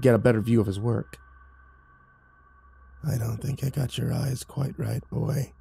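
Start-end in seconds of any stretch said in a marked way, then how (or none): 3.0-5.5 mock him with a rasp; raspy mocking voice. A narrator's reading. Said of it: "he mocked in a raspy voice".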